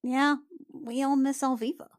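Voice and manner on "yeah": ordinary talk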